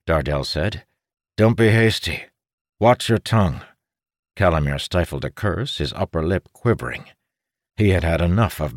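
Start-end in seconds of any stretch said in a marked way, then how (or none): 1.4-3.6 urgent caution. A narrator's reading. Second-in-command cautions his commander.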